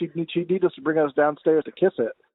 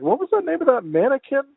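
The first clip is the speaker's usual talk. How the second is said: quoting her; 8 semitones higher than usual. Retold or imitated. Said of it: "she was like".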